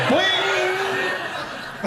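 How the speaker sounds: spring it up high like a cartoon; mock cartoon spring sound; boing noise. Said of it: "I'm like".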